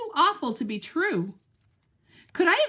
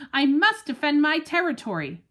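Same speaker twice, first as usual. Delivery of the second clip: usual speaking voice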